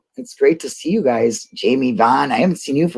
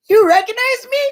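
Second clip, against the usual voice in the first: much higher and louder, stunned disbelief; astonished shout; 4 dB louder than usual. Retold or imitated. Repeating her own amazement at being recognized, high and loud. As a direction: shriek it like you can't believe it. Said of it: "I'm like".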